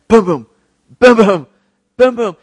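loud pounding boom sounds; mock drumbeat of a burning heart. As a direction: thump out a heartbeat loudly. Imitates heart pounding inside.

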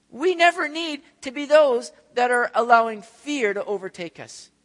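rising, emphatic pulpit preaching; fervent preaching. Acted. Preacher urging the congregation to trust God, fervent and emphatic. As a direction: press the truth home to the congregation. Preaching builds to full force.

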